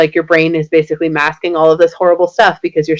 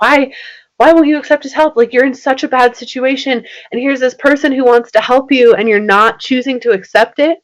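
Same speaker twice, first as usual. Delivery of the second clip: sad pleading; 7 semitones higher than usual. Retold or imitated. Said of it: "so sad".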